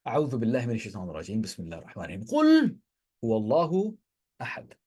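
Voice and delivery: measured tajweed chant; Quran recitation. Shown as a demonstration. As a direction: recite it melodically like in prayer. Recites the surah in Arabic.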